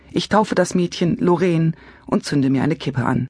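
usual speaking voice

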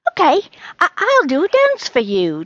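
high, loud stammered offer; eager cat. Acted. Charlie offers a dance as payment, high eager voice. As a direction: offer it brightly, tripping on the start.

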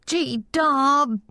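put-on high, drawn-out childish whine; high-pitched plaintive wail; wailing boy. A narrator's reading. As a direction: wail the name like a protesting kid. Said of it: "Charlie wailed".

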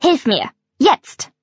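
slow, hard order; brusque command. Acted. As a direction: bark the order.

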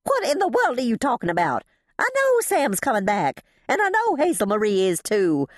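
fast, high exasperation; indignant outburst. A narrator's reading. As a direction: protest at being treated as confused. Indignant at being humoured.

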